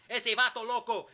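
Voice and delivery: loud, high put-on Spanish shout; hammed-up Spanish-speaking wrestler; Conan. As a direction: holler it as a mock jeer. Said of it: "And he goes".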